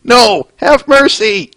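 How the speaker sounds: mock pleading monster. Swamp monster begging the pony, mock plea. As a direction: whine for mercy, half-hearted.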